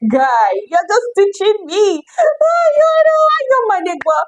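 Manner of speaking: very high, squealing mock complaint; laughing protest. Learner to the friend who keeps teaching her, laughing protest. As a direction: whine it playfully, breaking into laughter.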